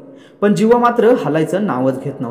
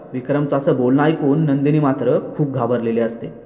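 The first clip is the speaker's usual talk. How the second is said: usual speaking voice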